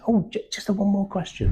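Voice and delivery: high, bumbling detective voice; lightly voiced bumbling Columbo. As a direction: act harmless and a little lost. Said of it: "Peter Falker's Columbo, like, slightly bumbling".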